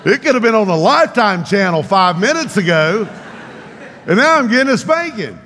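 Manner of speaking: high, loud, incredulous mock complaint; comic indignation. Boy amazed at spanking after tender moment, comically indignant.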